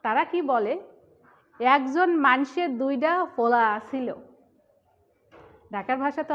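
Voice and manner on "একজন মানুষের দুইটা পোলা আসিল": louder, lively Dhaka accent; Dhaka dialect. Shown as a demonstration. Teacher shows students how Dhaka people say it, louder Dhaka accent. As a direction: punch it out like a Dhaka local. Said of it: "আর ঢাকায় যারা থাকে তারা কি বলে".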